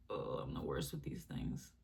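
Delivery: breathy self-scolding whisper under the breath; whispered aside. Real, not acted. Reader whispering to herself after forgetting a singer's name. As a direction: drop to a whisper, chiding yourself.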